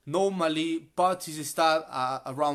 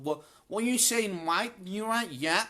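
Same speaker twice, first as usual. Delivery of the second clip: lightly put-on Cockney, pitched up at first; Cockney accent; 5 semitones higher than usual. Shown as a demonstration. Teacher showing students how Cockneys talk, light Cockney imitation. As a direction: toss out quick samples of Cockney street talk. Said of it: "They speak like that".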